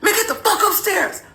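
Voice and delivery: high, strained mock yell at no real volume; imitating an angry husband's yell; teeth-gritted yell. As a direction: grit your teeth and order them away. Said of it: "Yelled, and like how you grit your teeth".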